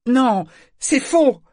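put-on high, loud boyish objection; outraged protest. A narrator's reading. Boy denies he ever volunteered, protesting in outrage. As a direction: object at once, voice shooting up. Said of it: "s'insurgea Yémin".

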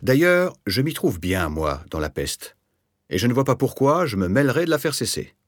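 defiant bravado, high burst at the start. A narrator's reading. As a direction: brazen it out with swagger. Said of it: "sur un ton de bravade". (sur un ton de bravade)

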